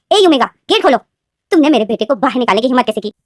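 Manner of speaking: bang on the door and call the omega out; loud angry call. Acted. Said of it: "वो बहुत जोर से बोलती थी". (दरवाज़े पर चिल्लाती गुस्साई सास)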